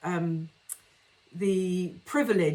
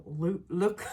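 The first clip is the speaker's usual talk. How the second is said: put-on mild northern accent. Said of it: "in his northern accent".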